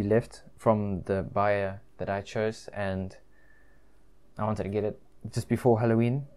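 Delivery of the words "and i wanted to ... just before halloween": normal talking voice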